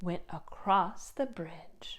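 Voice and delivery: soft whispered narration; whispered ending. A narrator's reading. Narrator whispering the goat's crossing. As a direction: whisper it as the tale winds down. Story winds down.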